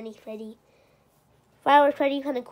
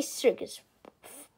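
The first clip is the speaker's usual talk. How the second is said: ordinary talk, nothing marked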